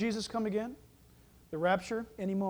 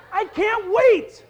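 loud, high, drawn-out exclamation; excited outburst; 9 semitones higher, 10 dB louder, much slower than usual. Real, not acted. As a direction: cry out with eager anticipation. Preacher bursts out about the resurrection.

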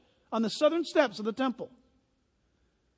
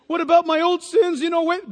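loud, higher acted outcry; mock protest; 6 semitones higher, 8 dB louder than usual. Preacher plays believer asking about the past, loud mock protest. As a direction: blurt it out as a worried believer. Acts out the believer's next question.

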